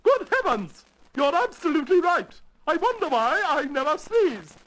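high regal voice full of surprise; astonished Queen. Acted. Queen realises Alice is right, astonished. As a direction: exclaim in high-pitched royal amazement.

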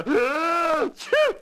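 high, drawn-out comic sneeze; sudden sneeze. Acted. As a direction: wind up and explode in a sneeze. Sneeze interrupts the Queen narration.

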